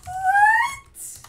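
loud, high rising what; shocked. Real, not acted. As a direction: cry out in shock. Shocked at losses.

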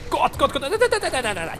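high rising panic; panicked. Real, not acted. Cornered gamer, panicked no-no-no. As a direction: plead in fright.

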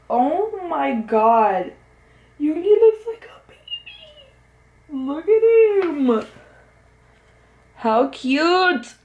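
very high, whispered squeal; breathless squeal. Real, not acted. Fan squealing at [name] looking like a baby.

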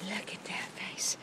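soft breathy whisper to the dog. Real, not acted. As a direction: whisper it close and tender.

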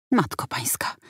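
breathy whispered dismay at the sandwich; hushed, aghast sigh. A narrator's reading. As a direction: breathe it out in disbelief. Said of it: "westchnął Kwiatek".

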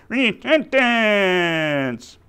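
high, very drawn-out puppet shout; long, high puppet cry, sliding down; puppet character. Acted. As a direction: holler the word slowly as a puppet. Puppet finally says the right word.

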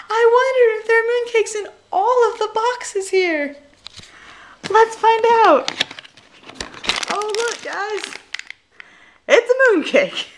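high, loud delighted shriek of discovery; excited surprise. Real, not acted. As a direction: gasp and marvel at each box. Discovers mooncakes in boxes.

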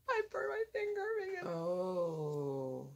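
drawn-out wail of pain; slow, tearful-sounding ouch; pained whine. Real, not acted. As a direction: whine through a burnt finger, slowly. Burns finger while curling her hair.

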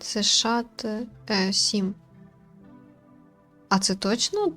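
normal talking voice

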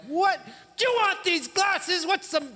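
high, loud, outraged yell; aggravated shout at referee. Retold or imitated. Sports fan yelling at the referee over a bad call, outraged. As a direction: blast the ref with indignant disbelief. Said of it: "you lift up your voice a little bit more".

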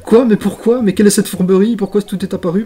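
mock outrage. Real, not acted. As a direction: protest the trickery with ham. Toutes les briques réapparaissent. (indignation théâtrale, plus aiguë et forte)

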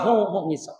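soft mock weeping, sketched briefly; half-hearted hushed wail; imitated crying. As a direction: imitate Musa's sobs, kept quiet. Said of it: "Bunyi teriak".

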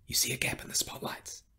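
hushed, breath-only question; covert whisper. A narrator's reading. Terry asking companions about a gap, whispered. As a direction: ask it under your breath, very softly.

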